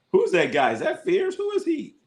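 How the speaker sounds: baffled. Real, not acted. Baffled by unknown player.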